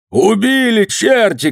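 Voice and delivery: high, drawn-out outcry; shouting. A narrator's reading. Nadeika crying out at the killing, high shout. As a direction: cry out in outrage, pitch way up. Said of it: "крикнул Надейка".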